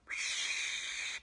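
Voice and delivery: long, breathy whoosh of wind; blowing wind. Shown as a demonstration. Storyteller invites children to make wind, blowing whoosh. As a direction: blow like a gust through the night. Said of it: "can you do the blowing".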